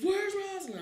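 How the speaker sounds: high, loud demanding mother; demanding yell. Retold or imitated. Mother arriving at the store, loud demand. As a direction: shout it like a furious mom.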